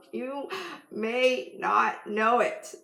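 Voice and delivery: "You ... may not know it" drawn out with a pause after You; slow right down, weighing each word of "may not know it"; slow, deliberate emphasis. Real, not acted. Slows down repeating for emphasis.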